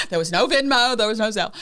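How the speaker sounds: high, loud, through laughter; laughing. Real, not acted. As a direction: laugh your way through the words. Laughing aside about outdated checkbooks.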